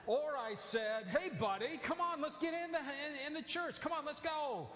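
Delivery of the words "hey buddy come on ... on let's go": put-on higher, friendly call to a small kid; cheerful come-on-let's-go to a child; casual coaxing. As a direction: coax the boy along like a buddy.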